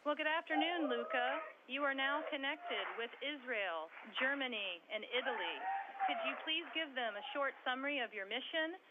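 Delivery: sci fi communicator. Real, not acted. Moderator calling over radio link.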